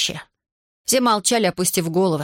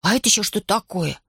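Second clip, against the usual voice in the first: ordinary delivery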